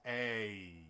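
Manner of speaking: A drawn out, gliding from eh to ee; slow two-part A; diphthong demo. Shown as a demonstration. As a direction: stretch A slowly so the glide shows. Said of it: "you have to move in the middle".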